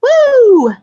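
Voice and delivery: high, loud "woo"; wrestler catchphrase whoop. Retold or imitated. Fan imitating Ric Flair's catchphrase, high whoop. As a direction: belt out a high, drawn whoop.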